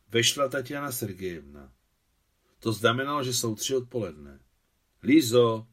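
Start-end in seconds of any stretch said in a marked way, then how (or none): 5.0-5.7 louder, glad greeting; joyful. A narrator's reading. Tatiana Sergejevna greets Líza as she enters, joyful. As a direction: call the name with joy. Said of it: "řekla radostně".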